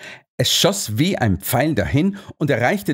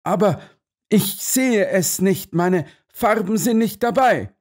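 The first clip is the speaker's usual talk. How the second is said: high-pitched, agitated protest; great agitation; 9 semitones higher than usual. A narrator's reading. Colonel cannot see his colours, greatly agitated. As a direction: object anxiously, voice pitched well up. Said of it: "sagte der Oberst in großer Erregung".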